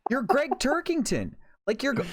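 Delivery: high-pitched exasperated rant; heated rant. Real, not acted. A podcaster calling Oscar fans boring, heated and high. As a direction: lay into the snobs, heated. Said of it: "I'm getting heated".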